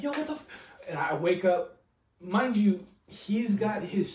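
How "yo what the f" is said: high, shocked angry father; a dad yelling at his son about his wrecked truck. Retold or imitated. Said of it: "Wakes me up, like".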